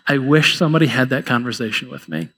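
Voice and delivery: low, subdued, wistful admission; downcast regret. Acted. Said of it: "looks down and goes".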